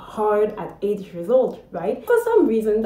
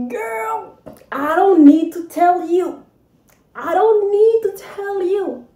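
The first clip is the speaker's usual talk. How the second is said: high, loud, slow triumphant tease; gleeful gloating; 10 semitones higher, 7 dB louder, slower than usual. Real, not acted. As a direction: savor the coming revenge. Gloating to viewers.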